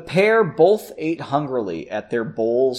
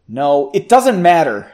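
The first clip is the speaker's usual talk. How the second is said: interrupting; 4 semitones higher, 8 dB louder than usual. A narrator's reading. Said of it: "Margot interrupted".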